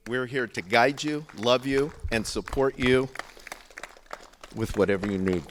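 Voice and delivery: booming, heartfelt welcome; swelling loud. Real, not acted. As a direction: voice rising big and loud. Emotional climax of speech.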